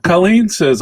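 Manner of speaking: normal talking voice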